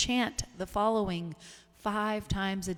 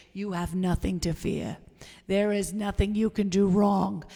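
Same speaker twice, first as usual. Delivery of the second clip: Marcy Steinberg; 6 dB louder than usual. Acted. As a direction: deliver the blessing in a hammed-up character voice. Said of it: "from my inner Marcy Steinberg to you".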